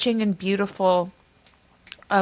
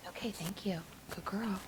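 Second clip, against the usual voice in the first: hushed, soft reassurance to a pet; calm the frightened dog softly; quiet coaxing voice for a scared dog; soothing the dog; 15 dB quieter than usual. Real, not acted. Hushing her dog during recording.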